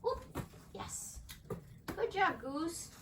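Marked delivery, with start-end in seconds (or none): none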